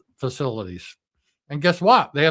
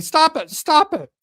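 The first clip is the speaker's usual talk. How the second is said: much higher, louder whiny plea; mock Trump; 12 semitones higher, 8 dB louder than usual. Trump begging Israel to stop, mock panic. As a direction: squeal it like a panicked child. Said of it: "Trump's saying".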